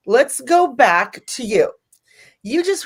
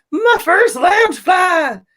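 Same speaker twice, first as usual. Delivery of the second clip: much higher, slower and louder thrilled cry; excited squeal; 8 semitones higher, 4 dB louder, slower than usual. Retold or imitated. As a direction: gush over the gift. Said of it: "I was like this".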